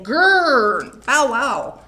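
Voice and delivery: loud playful growl and bark; angry dog growl. Retold or imitated. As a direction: growl like a cross dog for the kids. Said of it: "he's saying".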